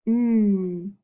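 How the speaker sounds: "n" hummed alone as a held nasal n; isolated sound. Shown as a demonstration. Teacher modelling the n of gnat, humming it clearly. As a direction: hold the "n" sound by itself. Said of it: "Ghost digraph".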